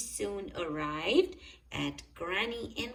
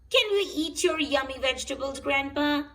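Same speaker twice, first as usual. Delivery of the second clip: fast, loud, higher eager question; eager Peppa; 6 semitones higher, 7 dB louder, faster than usual. A narrator's reading. Peppa asks Grandpa for vegetables, fast eager plea. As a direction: rush it out hungrily. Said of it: "asked Peppa".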